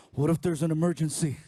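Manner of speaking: put-on low, loud voice of an arguing teen; teenage son. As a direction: imitate a son pushing back.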